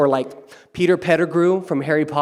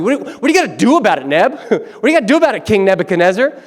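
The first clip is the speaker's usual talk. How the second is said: fast, higher and louder goading; eager egging on; 7 semitones higher, 6 dB louder, faster than usual. Pretend. Courtiers egging Nebuchadnezzar on, fast and high. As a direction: rush him into action, giddy.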